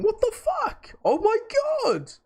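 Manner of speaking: high squealed mock outburst; shocked parents. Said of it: "my parents going".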